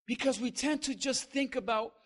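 usual speaking voice

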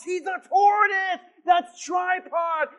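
loud and high, the second line drawn out slowly; excited shout. Retold or imitated. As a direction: yell it out across the lake. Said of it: "and he goes".

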